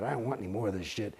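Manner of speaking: low, soft, fast retold mutter; muttered quote. Telling the auditors he's quitting, low fast mutter. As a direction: mutter it like you're done with it all.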